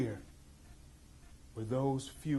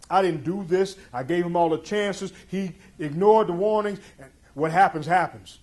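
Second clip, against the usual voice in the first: telling himself; 5 semitones higher, 10 dB louder than usual. Retold or imitated. To himself before the shot, rising loud self-justification. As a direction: talk yourself into it, harder each line. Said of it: "I'm telling myself".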